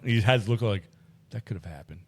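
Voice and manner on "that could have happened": much lower and hushed, dryly unconvinced; mock deadpan. Dog's look voiced as a low, hushed, doubtful mumble. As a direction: shrug it off in a flat, low mumble.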